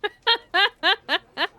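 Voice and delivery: high giggly imitation of a streamer laugh; mocking VTuber laugh. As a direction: parody her cutesy anime giggle. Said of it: "You made fun of my laugh".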